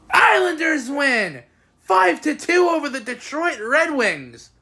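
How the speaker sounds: high, slow announcer call; triumphant arena-style score call; victory announcement. Real, not acted. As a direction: proclaim the score with long vowels.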